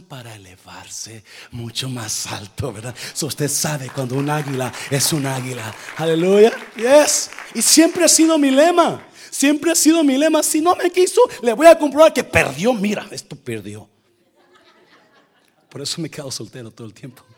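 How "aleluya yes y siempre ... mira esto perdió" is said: loud, higher proclamation; fervent preacher declaring his motto; preaching at full force. Acted. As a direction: shout it from the pulpit.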